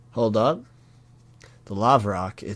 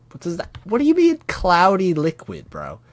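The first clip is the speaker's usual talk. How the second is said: high, loud incredulous outburst; real disbelief; 8 semitones higher, 6 dB louder than usual. Real, not acted. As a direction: demand an explanation, baffled and grossed out. Reader reacts to cloudy vomit.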